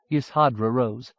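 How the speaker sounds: usual speaking voice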